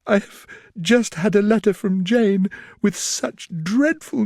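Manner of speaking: distressed after tears. A narrator's reading. Elizabeth tearfully telling Darcy of Lydia's elopement, distressed. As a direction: pour out the bad news, near tears. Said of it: "At length she spoke again".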